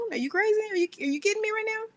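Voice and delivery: high, fast disbelief; can't believe the nerve; incredulous rant. Real, not acted. Ranting about a text saying call me.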